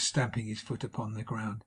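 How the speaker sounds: ordinary delivery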